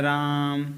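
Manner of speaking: राम with a long, level held आ; prolonged vowel. Shown as a demonstration. Teacher showing plut swar from Ramayana, राम drawn out. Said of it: "तेज़ी से बोला जाता है राम".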